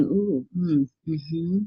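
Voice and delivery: murmured hmms, fading to nothing; muttering over the cards unsure; mock puzzled murmur; mumbling to yourself. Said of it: "we start a reading by going".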